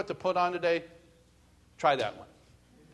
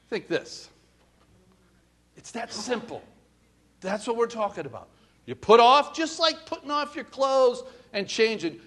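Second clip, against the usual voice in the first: ordinary talk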